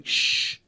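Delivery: sharp imitated shush. Annoyed critic behind the row, imitated shushing. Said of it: "you hear this guy going".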